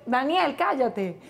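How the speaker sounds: ordinary talk, nothing marked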